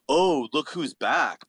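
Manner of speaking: slow, sing-song mock greeting; hammed-up imitation of a snide hometown acquaintance; mocking sneer. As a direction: drawl it like a smug local barfly.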